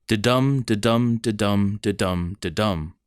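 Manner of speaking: weak then strong beat, da-DUM, repeated; iamb rhythm. Shown as a demonstration. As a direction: tap out each da-DUM evenly, fading out. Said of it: "a weak stressed syllable followed by a strong stressed syllable".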